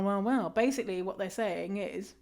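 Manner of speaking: normal talking voice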